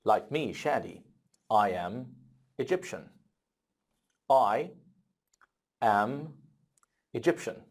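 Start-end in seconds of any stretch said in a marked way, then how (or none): 4.3-7.6 word-by-word model. Shown as a demonstration. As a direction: say it one word at a time for learners. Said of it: "how can I talk about my nationality".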